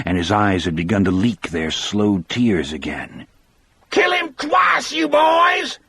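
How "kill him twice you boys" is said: very high, strident woman's scream; shrieking for vengeance. A narrator's reading. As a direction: scream it out, ragged and slow. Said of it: "Marjorie Deterick suddenly screamed".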